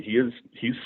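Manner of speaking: usual speaking voice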